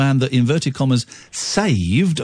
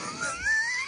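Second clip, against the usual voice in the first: high squeal of laughter; 12 dB quieter than usual. Real, not acted. Host laughing at tweets.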